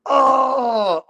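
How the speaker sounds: high, loud anguished cry at a friend; real cry of being trolled; frustrated yell. Real, not acted. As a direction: wail the name in real exasperation. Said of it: "I'm so mad at you right now".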